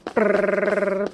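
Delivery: rolled lips like a drum roll. Pretend. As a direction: buzz a mock drum roll. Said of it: "drum roll, please".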